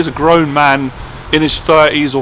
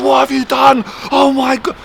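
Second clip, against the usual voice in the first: much higher, imitating a panicked outburst; retold shouting; 10 semitones higher than usual. Boss panics after glass smashes, imitated shouting. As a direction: mimic the boss freaking out over the glass. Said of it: "And he started shouting".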